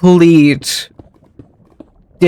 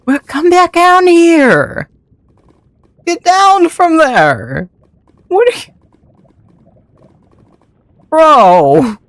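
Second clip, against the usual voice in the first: much higher, slow exasperated calling; high pleading calls; 11 semitones higher, slower than usual. Real, not acted. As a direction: plead with the runaway mount, high and drawn out. Pleading with a wandering Strider.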